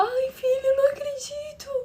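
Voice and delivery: lamento bem agudo, imitando choro; choro da mãe. Retold or imitated. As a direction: imite uma mãe incrédula chorando. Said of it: "Começou a chorar". (lamento choroso e agudo de mãe)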